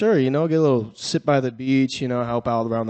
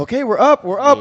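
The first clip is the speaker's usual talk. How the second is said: high, louder relief at good news; hopeful sports-fan excitement, higher and louder; retold excitement; 10 semitones higher, 5 dB louder than usual. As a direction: re-enact cheering a lead, pitch jumping up. Retelling delight on seeing the score.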